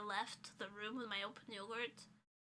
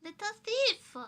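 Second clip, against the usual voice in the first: squeaky cat scold; 10 semitones higher, 8 dB louder than usual. Real, not acted.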